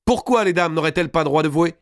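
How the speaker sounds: put-on high, keen girlish question; high-voiced plea to the hall; eager young lady. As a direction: pipe up in indignant appeal. Excited demoiselle demands right to vow.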